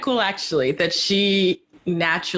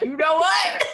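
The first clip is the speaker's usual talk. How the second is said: high, playful pretend defiance; sassy retort pitched way up, all in fun; mock comeback; 11 semitones higher than usual. Joking about clowning back, high playful comeback.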